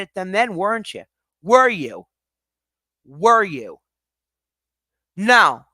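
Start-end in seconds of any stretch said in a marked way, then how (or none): 1.4-3.8 angry demand. Real, not acted. Angry rant at chat. 5.2-5.6 furious loud no. Real, not acted. Streamer snapping at chat, loud angry no.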